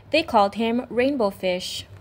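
ordinary delivery

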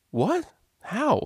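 high, sharp defensive What; defensive outburst. Acted. DJ reacting to the teacher's critique, defensive. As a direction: fire back in disbelief. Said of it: "Vinyl asked defensively".

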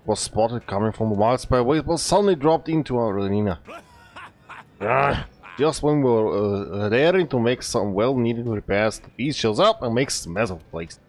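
hammed-up bad Scottish accent for the blacksmith; a gruff-sounding mock Scottish smith calling the hunter over, lively; fake Scottish accent. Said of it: "I had to do that fake bad Scottish accent".

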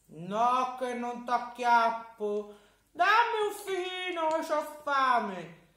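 high, loud, pleading giant; a hungry storybook giant begging sweetly. Acted. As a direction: giant coaxing the boy for a fig. Giant promises not to catch.

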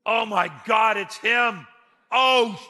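high, loud shocked exclamation; shocked gasp. Retold or imitated. The brothers recognizing Joseph, shocked and high. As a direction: gasp it out in alarm. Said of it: "They're going".

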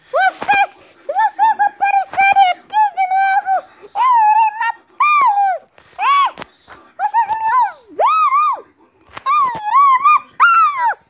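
high, loud villain threat. Acted. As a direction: shriek the threat like a cartoon villain.

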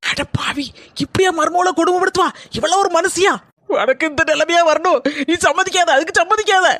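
loud high outraged cry; indignant outburst. Acted. Daughter-in-law outraged by the lies, loud and high. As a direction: blast her with indignation.

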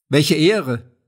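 a delighted host's exclamation, lightly voiced; exclaiming. A narrator's reading. Innkeeper greets his unexpected guest, exclaiming. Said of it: "rief Jatlowka aus".

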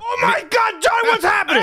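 high-pitched panicked shout; alarmed father. Acted. Father woken by his screaming son, high alarmed yell. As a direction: burst out in startled alarm.

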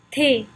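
French "Thé", said "tay" with a plain t; "Thé" modelled in French for learners; French pronunciation drill. Shown as a demonstration. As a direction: say "Thé" with a t, no th sound. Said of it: "Practice the pronunciation with me".

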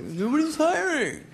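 very high, slow whine; whiny teen. Retold or imitated. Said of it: "And I was like".

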